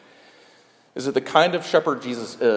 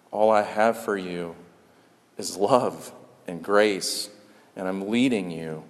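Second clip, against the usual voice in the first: soft, low, unhurried reassurance; Good Shepherd; 3 semitones lower, 4 dB quieter than usual. Acted. The Good Shepherd to his sheep, tender and soft. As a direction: speak tenderly and lower, with space between phrases. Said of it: "his voice says things like".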